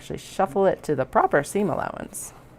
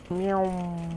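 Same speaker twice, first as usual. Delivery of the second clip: slow drawn-out cat meow; a slow, distant-sounding meow; playful meow; 3 semitones higher than usual. Pretend. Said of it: "Just a slow meow".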